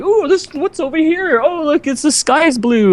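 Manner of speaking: high, sing-song fake distraction; feigned oblivious bystander. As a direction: pretend you saw nothing and gaze at the sky. Mocking bystanders pretending not to notice.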